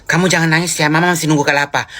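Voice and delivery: normal talking voice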